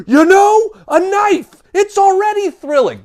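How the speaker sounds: high, loud gasping excitement; mock thrill. Host finding a knife in the box, mock thrill. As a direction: play up a shocking discovery.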